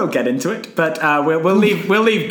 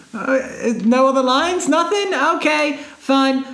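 higher, slow let-down "okay, fine"; deflated Tom Hanks; 8 semitones higher than usual. Retold or imitated. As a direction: shrug it off, hurt but resigned. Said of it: "and he was like".

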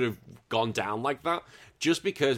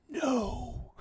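mock Strange; 13 semitones higher, 6 dB quieter than usual. Doctor Strange answer, high voice.

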